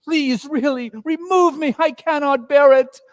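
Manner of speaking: anguished Scrooge begging the spirit; desperate Scrooge. Acted. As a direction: cry out that you cannot bear it. Scrooge begs again.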